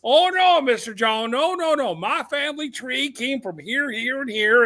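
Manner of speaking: high-pitched mock Southern protest; hammed-up Southern indignation; Southern good old boy. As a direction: protest like a put-out Alabama caller. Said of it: "good old boys from, like, Alabama".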